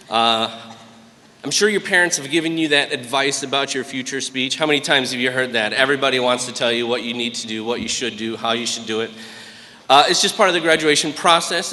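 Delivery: usual speaking voice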